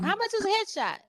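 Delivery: high-pitched caller asking about a headshot; mock caller. Client phoning for a headshot, high mock caller voice. Client calling asks price.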